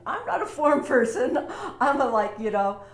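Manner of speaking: laughing. Real, not acted. Telling viewers she's no paperwork person, laughing. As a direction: break into a laugh mid-line. Admitting she hates paperwork.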